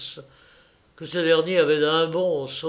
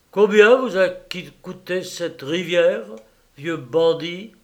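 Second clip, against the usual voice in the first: louder, mocking question; taunting thief; 4 semitones higher, 7 dB louder than usual. Raffles taunts the millionaire, louder and mocking. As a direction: needle him.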